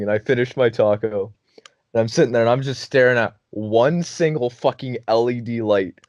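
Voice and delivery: usual speaking voice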